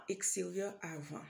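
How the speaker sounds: ordinary delivery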